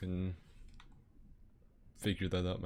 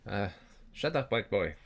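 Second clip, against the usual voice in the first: put-on louder, Laotian-accented retort; Mister Khan accent; 4 dB louder than usual. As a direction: snap back in a heavy Lao accent. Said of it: "you sound like Mister Khan".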